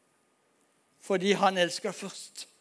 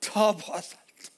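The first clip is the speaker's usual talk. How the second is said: slow, moved; much slower than usual. Real, not acted. Repeated climax line.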